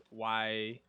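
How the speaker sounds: ordinary talk, nothing marked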